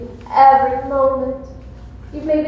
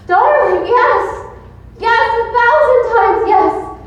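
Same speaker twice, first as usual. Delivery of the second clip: loud, high ecstatic acceptance; ecstatic yes; 10 semitones higher, 6 dB louder than usual. Acted. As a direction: shout yes to the proposal. Accepting proposal on phone.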